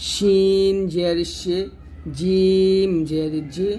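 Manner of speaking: শিন জের শি, জিম জের জি drawn out; each syllable of জিম জের জি stretched; slow letter demo. Shown as a demonstration. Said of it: "তিনটা একইভাবে হবে". (ধীরে উচ্চারণ)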